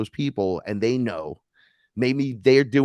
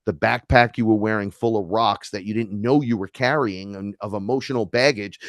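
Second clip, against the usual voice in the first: ordinary talk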